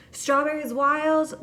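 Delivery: loud, high call-out of Strawberries Wild; yelled order. Retold or imitated. Worker calling the order to the smoothie makers, loud and high. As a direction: holler the order to the back. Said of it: "a rule that they want you to yell it out".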